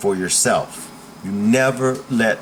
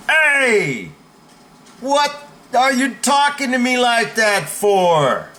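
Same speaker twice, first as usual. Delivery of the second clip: high, forceful challenge to a bully; demonstrating a commanding, indignant shout; strong shout back; 10 semitones higher, slower than usual. Shown as a demonstration. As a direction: raise the voice and push back hard. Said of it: "but the tone of my voice".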